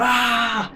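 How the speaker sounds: mock scream. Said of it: "He's like".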